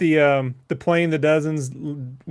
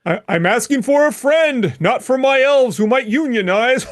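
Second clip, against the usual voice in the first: high, put-on Santa voice; mock Santa; 7 semitones higher, 4 dB louder than usual. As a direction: play Santa, ending on ho-ho-ho. Jokes as Santa asking about elves.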